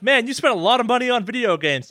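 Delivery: mock nagging. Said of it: "It's still like".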